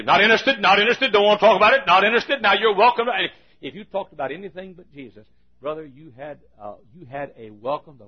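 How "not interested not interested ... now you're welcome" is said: loud, high, insistent brush-off; brusque refusal. Retold or imitated. Uncle refusing talk of getting saved, loud insistent dismissal. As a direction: shut the visitor down loudly.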